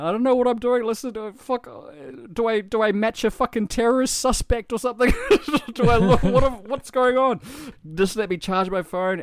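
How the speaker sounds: bawling plea. Retold or imitated. Man in the interrogation room begging police, bawling. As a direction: relive the breakdown, voice cracking with panic. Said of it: "I was bawling my eyes out".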